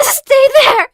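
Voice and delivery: high, loud tearful shout; crying shout. Acted. Wounded sister cries out to her brother to stay hidden. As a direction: cry out through pain.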